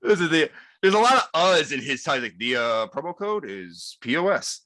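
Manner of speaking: halting Obama impression full of uhs; Barack Obama. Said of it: "give us your best".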